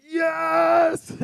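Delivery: triumphant cry of yes; joyful shout. Retold or imitated. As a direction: yell it from across the gym like a proud father. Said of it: "in the big corner of the gym going".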